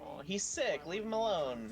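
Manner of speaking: mock pleading. Sticking up for a sick monster in the trailer, mock pleading. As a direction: plead for the poor creature in mock sympathy.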